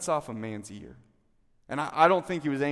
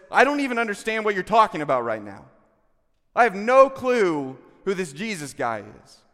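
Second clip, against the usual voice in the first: loud, raised-pitch protest; emphatic denial; 6 semitones higher, 10 dB louder than usual. Acted. Peter disowning Jesus to his questioner, emphatic denial. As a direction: deny it forcefully, voice pushed up high.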